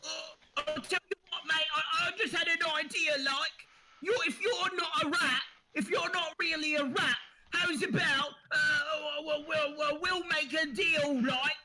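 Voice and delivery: high, whiny Cockney rat in a flap; squeaky Cockney rat voice; panicky Cockney rat. Acted. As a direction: wail like a fretting street rat. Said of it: "It says".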